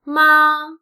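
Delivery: MA held high and level; "Ma" in a steady high tone; first tone. Shown as a demonstration. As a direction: sustain "Ma" on one high, flat pitch. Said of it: "is the first tone".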